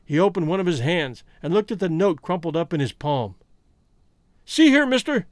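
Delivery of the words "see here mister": high, sharp and suspicious call; loud cry. A narrator's reading. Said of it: "he cried".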